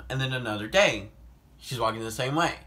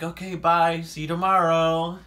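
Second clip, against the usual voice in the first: fake cheerful goodbye; 6 semitones higher, 7 dB louder than usual. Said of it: "I'm like".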